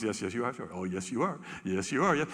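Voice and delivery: low soft agreeing; adoring male tiger. Retold or imitated. Male tiger flattering the female, low and adoring. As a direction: fawn over her.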